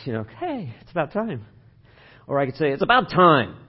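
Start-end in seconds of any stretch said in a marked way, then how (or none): 0.4-1.5 cheerful, easygoing. Shown as a demonstration. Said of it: "same words with a little different inflection". 2.7-3.6 louder, stressing about, a reproachful it's about time; pointed reproach. Shown as a demonstration. Pastor showing the congregation a scolding inflection, reproachful. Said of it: "same words with a little different inflection".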